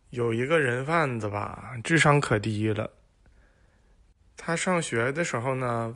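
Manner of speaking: usual speaking voice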